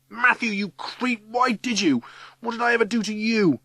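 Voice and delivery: high-pitched, wounded accusation; anguished outburst. A narrator's reading. Ryan confronting the creep who betrayed him, anguished. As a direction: demand an answer in misery. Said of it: "Despair and misery are stamped all over Ryan".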